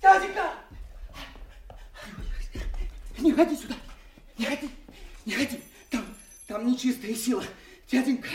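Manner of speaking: shrill panicked cries; frightened shrieking. Acted. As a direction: scream in terror. Fool fleeing the hovel in fright.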